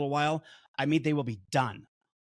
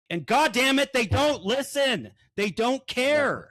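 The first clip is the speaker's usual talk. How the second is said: loud, high, slowed exasperated rant; frustrated outburst; 9 semitones higher, 5 dB louder, slower than usual. Real, not acted. Venting to his co-hosts about clients who won't listen, loud and exasperated. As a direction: vent the frustration, dragging the words out. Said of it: "You guys can tell I'm a little emotional".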